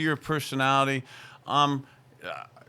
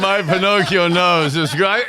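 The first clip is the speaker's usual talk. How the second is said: loud, sing-song shout of the nickname; bursting out laughing at a teammate's nickname, loud; real yell; 3 semitones higher, 11 dB louder than usual. Real, not acted. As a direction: belt it out across the studio. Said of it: "I didn't mean to yell that much".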